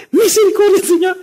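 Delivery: high frantic cry for mercy; panicked plea. Retold or imitated. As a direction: shriek the prayer like a terrified woman. Mother cries out during earthquake.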